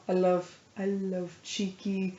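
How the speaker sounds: ordinary talk, nothing marked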